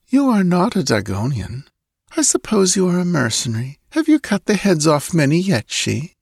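put-on high, sleepy girl's voice; idle questions through a drowsy haze. A narrator's reading. Girl sizing up the mercenary, drowsily. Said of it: "she repeated drowsily".

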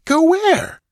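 very high-pitched, incredulous question; startled cry. A narrator's reading. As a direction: ask as if taken aback. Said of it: "he cried, starting".

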